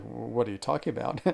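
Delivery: ordinary delivery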